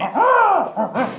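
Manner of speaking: sharp high yelp; loud yelp of pain. Acted. Detective hit on the head, loud yelp of pain. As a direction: cry out as if struck.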